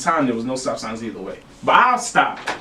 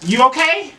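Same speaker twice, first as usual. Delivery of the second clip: higher-pitched imitation of mama checking in; concerned mother; 11 semitones higher, 3 dB louder than usual. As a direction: voice a mother's quick worried check. Voices worried mother on phone.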